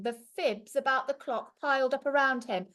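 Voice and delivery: usual speaking voice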